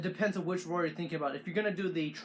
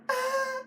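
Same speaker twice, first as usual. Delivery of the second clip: a high roar pushed out on the breath; T-Rex roar, breath out; exhaled roar. Shown as a demonstration. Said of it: "exhale like".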